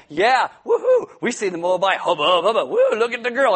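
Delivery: loud, hooting catcalls; hooting young men eyeing a girl; rowdy guys ogling. Retold or imitated. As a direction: whoop it up like leering young guys.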